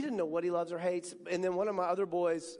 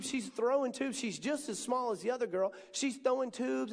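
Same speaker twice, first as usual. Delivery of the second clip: ordinary delivery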